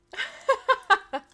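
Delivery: high, loud cackling laugh. Real, not acted. Streamer laughing about mom dipping out, high laugh.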